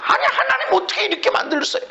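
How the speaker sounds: 높고 빠른 항의; 하나님께 따지듯 빠르게 높여서. Acted. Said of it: "원망, 불평, 탄식이 일어난다". (불평을 흉내 내며 높고 다급하게)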